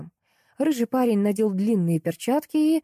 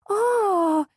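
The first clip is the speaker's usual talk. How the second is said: acted high-pitched sustained wail; old lady's drawn-out scream; high long scream; 13 semitones higher than usual. A narrator's reading. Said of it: "заорала Жози".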